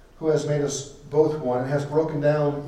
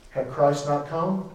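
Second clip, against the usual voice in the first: ordinary talk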